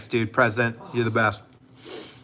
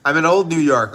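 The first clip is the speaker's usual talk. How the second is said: put-on loud, higher-pitched New York accent; 4 semitones higher, 9 dB louder than usual. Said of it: "then I did my".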